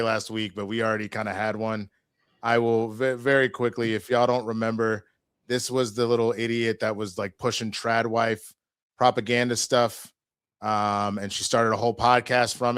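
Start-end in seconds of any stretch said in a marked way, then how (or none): none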